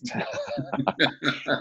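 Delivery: high, soft burst of laughter; amused laugh breaking up the sentence; real laugh. Real, not acted. Laughing at own joke about Chinese translation, amused laugh.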